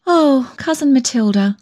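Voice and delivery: high, imploring appeal; remorseful pleading. A narrator's reading. Remorseful girl implores her cousin.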